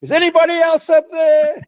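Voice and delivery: high, loud comic call upward; punchline shout. Retold or imitated. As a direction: shout it up the cliff, hopeful. Punchline, Bob calls up.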